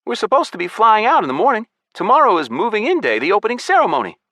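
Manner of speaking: very high, loud character voice; Malik. A narrator's reading. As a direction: pipe up high with a worried reminder.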